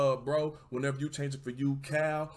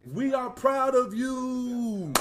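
drawn-out, much higher, louder praise; proud proclamation; 12 semitones higher, 7 dB louder, much slower than usual. Real, not acted. Host praising a viewer who got clean, loud and drawn out. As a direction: sing out the pride slowly.

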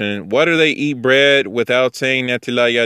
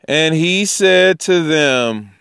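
slow, higher-pitched recitation; scripture reading; 7 semitones higher, much slower than usual. Acted. Teacher reading the Gospel to a study group, measured recitation. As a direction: read it out slowly, stretching each word.

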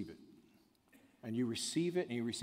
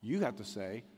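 ordinary delivery

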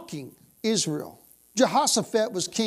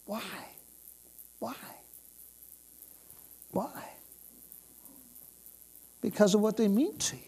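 usual speaking voice

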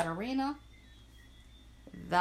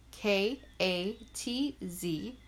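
spelled out slowly; much slower than usual. Shown as a demonstration. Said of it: "spelling the name".

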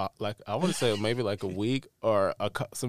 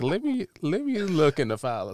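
higher-pitched helpful lady voice; imitated phone lady; 7 semitones higher, 4 dB louder than usual. Admissions lady offering to check the file, imitated higher. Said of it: "she was like".

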